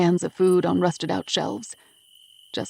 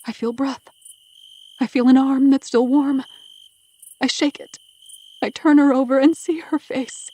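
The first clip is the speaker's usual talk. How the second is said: overwhelmed, tense narration; 7 semitones higher, 4 dB louder than usual. A narrator's reading. Narrator discovers child is alive.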